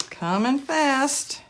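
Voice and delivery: slow, drawn-out "Coming fast."; slow echo. Real, not acted. Slowed echo of own line.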